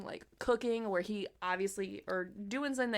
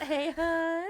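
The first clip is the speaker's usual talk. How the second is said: "Hey, hun." much higher, uncanny, rising; eerie mimic copy; 9 semitones higher, 5 dB louder than usual. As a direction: make "Hey, hun." a creepy imitation of a loved one. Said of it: "their mini-me calls out".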